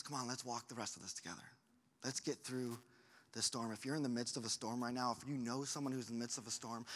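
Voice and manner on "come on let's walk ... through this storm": soft, low and tender; Jesus gently inviting Peter onward; gentle invitation. Retold or imitated. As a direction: invite him to walk with you.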